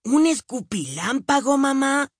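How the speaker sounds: put-on high, wide-eyed little boy; amazed little boy. A narrator's reading. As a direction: gasp it out like a startled kid. Billy amazed.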